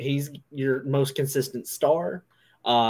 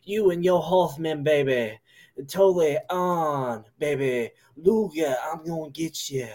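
Dusty Rhodes impression; 3 semitones higher, 5 dB louder than usual. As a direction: bark at Flair like Dusty. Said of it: "I had to go into some Dusty".

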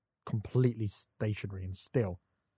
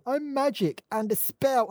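high, drawn-out mocking voice; mock fantasy character; 11 semitones higher, slower than usual. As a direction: send up a silly magic character. Mocks fantasy film dialogue.

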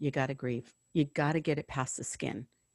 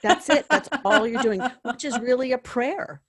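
loud, higher, through real laughter; laughing agreement; 7 semitones higher, 8 dB louder than usual. Real, not acted. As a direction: agree heartily while laughing. Real laughter during lively agreement.

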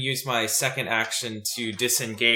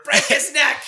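high, loud yell from the corner; 13 semitones higher, 8 dB louder than usual. Acted. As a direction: yell it across the room as a heckler. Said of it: "I'm yelling from the corner".

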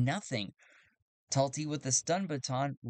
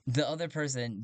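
ordinary talk

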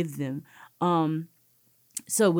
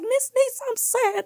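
put-on little girl's voice; slower than usual. Teacher and crying child voiced.

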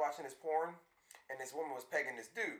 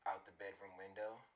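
low, soft, sleazy nasty voice; 7 semitones lower, 11 dB quieter than usual. Pretend. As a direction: drop low and soft, leering. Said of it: "Your nasty voice".

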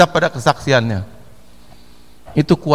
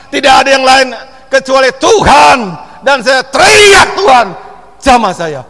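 loud, high, fervent prayer building to a cry; shouting prayer; 6 semitones higher, 9 dB louder than usual. Shown as a demonstration. Preacher demonstrating how to cry out to God for healing, shouting. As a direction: shout heal me now with full force. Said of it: "Teriak sama Tuhan".

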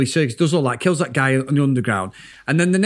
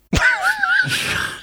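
squealing giggle, very high; real giggle, much higher; high squeal laugh. Real, not acted. Laughing at a smutty remark.

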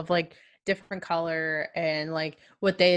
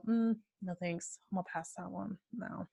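a quiet, much softer, offhand refusal; mock dismissive brush-off; 14 dB quieter than usual. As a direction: wave it away quietly, like you can't be bothered. Dismissive person brushing off equality.

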